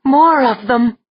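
high, loud, alarmed; alarmed cry. Acted. Alarmed at sighting.

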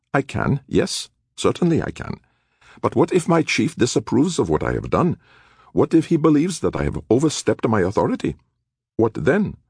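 high, fretful official voice; a worried clerk hedging; nervous bureaucrat. Acted.

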